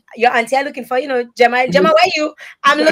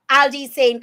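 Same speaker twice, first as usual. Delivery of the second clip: usual speaking voice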